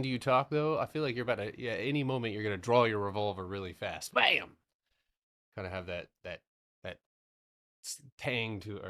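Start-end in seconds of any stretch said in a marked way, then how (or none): 4.1-4.6 high loud mock gunshot; gunshot bam. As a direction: shout bam like a gun firing. Imitating revolver shot.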